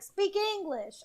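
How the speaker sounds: high, loud mock Southern accent. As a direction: bark it out as a Southern English-only type. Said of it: "I did a southern".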